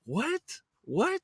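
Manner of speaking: high incredulous question; disbelieving yelp. Real, not acted. Disbelief at the count.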